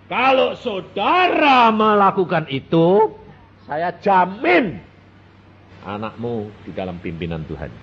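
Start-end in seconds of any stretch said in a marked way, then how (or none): none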